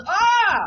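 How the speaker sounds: loud high startled scream. Retold or imitated. Patient startled by a stranger, a loud high scream. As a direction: scream like you've been scared awake. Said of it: "he looks at me and he goes".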